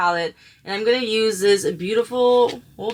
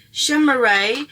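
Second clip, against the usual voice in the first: playful emphasis; 5 semitones higher, 6 dB louder than usual. Real, not acted. Playful repeat of shimmery.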